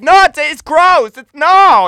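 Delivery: high, slow, loud mock whine; whiny kids. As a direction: whine it like a grossed-out kid. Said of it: "we're like".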